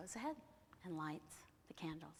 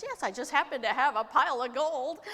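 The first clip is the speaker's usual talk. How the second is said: higher, loud, showy boast; boastful goblin; 7 semitones higher, 13 dB louder than usual. Acted. Goblin boasts of gold to Herschel, loud and showy. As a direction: brag loudly about your riches.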